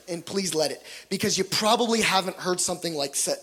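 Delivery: usual speaking voice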